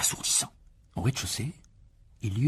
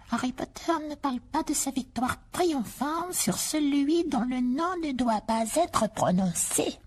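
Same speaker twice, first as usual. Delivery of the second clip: high, slow house-elf voice; slow, high, earnest elf; house elf Dobby; slower than usual. Acted. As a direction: squeak it out like an earnest little elf.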